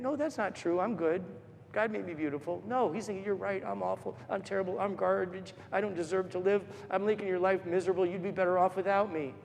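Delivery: soft, subdued self-blame; quiet inner voice. Retold or imitated. Five-year-old boy agreeing he is awful, quiet inner voice. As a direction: take it down quietly, as a hurt child's thoughts.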